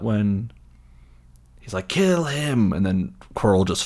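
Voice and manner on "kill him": high, drawn-out command; imitated dark lord's cry; villain's kill order. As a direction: send the minion to attack.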